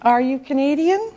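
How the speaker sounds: "Canadian" rising at the end; teacher's demo of a rise on "Canadian"; modelled question, rising tune. Shown as a demonstration. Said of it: "and how you say it".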